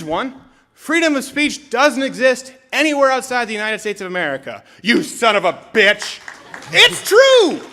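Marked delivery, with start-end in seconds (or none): 4.8-6.2 mock outrage. Mock outrage at free speech joke. 6.7-7.6 much higher and louder, a squeal; sudden high squeal; squeaky punchline. Acted. Squeaky punchline after outburst.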